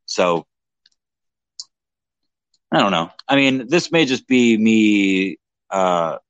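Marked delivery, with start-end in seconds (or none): none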